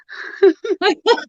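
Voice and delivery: high giggle. Real, not acted. Laughs calling her rival.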